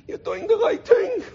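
very high, slow, emotional squeeze; breathless old aunt. Retold or imitated. Old aunt's words remembered by nephew, breathless and emotional. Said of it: "She was all out of breath".

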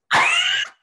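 loud, high shriek of laughter; high squealing laugh. Real, not acted. Laughing at her own raycation pun, with a high squeal. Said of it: "recation sounds stupid".

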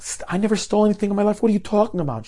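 high, quick protest; acted indignant disbelief; appalled. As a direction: protest your innocence in a rush. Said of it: "This mother is appalled".

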